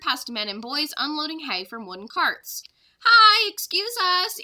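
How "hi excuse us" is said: loud, high, slow greeting call; waving to farmers as they pass; loud cheerful call-out; friendly yell. Acted. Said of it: "Annie yelled".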